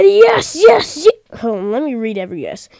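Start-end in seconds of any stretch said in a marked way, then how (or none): 0.0-1.2 high, loud eager yes voice; character voice. Acted. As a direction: squeak out an eager character's yeses. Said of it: "He said".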